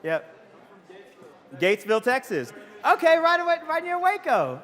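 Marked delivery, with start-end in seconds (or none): 2.8-4.6 high, loud delighted outburst; real excitement. Real, not acted. Speaker hearing someone is from near Waco, real excitement. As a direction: cheer a fellow hometowner.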